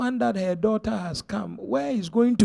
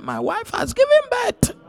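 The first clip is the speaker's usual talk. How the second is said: excited husband. Retold or imitated. Husband's message, acted out.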